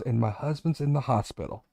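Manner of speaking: retold choking up. Golfer retelling an older lady's bad news, mildly choked up. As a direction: quote her quietly, with a touch of choking up. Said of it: "choking up".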